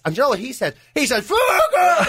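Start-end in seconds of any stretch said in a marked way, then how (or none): 1.2-2.1 high, loud shout through a laugh; raging shout. Retold or imitated. Retelling Jim's reply, raging shout with a laugh. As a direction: bark it out in a rage, half laughing. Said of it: "There's flames coming out of his ears".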